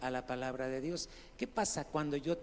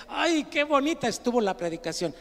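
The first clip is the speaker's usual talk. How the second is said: higher, louder mock delight; mock-gushing congregant; gushing churchgoer; 8 semitones higher, 9 dB louder than usual. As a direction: imitate a tickled listener praising the sermon. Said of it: "la gente se está riendo y dice".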